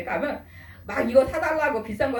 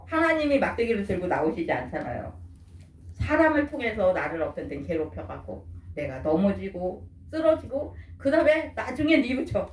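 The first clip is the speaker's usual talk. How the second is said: usual speaking voice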